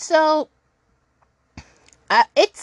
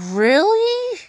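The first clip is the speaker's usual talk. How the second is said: incredulous; 11 semitones higher than usual. Retold or imitated. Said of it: "and I'm like".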